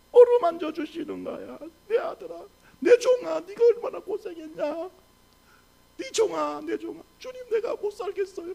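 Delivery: high, crying voice; tearful preaching. Real, not acted. Pastor moved to tears voicing God's comfort, tearful and high. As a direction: let the voice break with tears.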